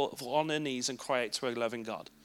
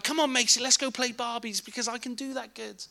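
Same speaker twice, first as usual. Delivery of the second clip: cheery dad; 7 semitones higher than usual. Retold or imitated. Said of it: "And I'd be like".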